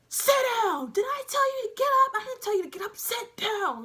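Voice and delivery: very loud, high-pitched furious bark; drill instructor yelling. Retold or imitated. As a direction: explode at the recruits full force. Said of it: "He's yelling at us".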